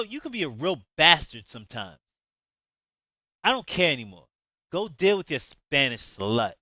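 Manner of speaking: loud, scornful angry retort; with an attitude. Acted. Shantae telling Jay off before leaving, loud angry attitude. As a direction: hurl insults as she leaves. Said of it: "Shantae said with an attitude".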